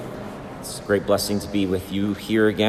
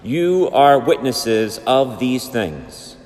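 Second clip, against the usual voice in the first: Gospel reading; 3 semitones higher than usual. Real, not acted.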